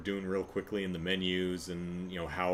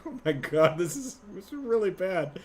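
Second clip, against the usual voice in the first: dismayed; 9 semitones higher than usual. Retold or imitated. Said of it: "It's, it's like".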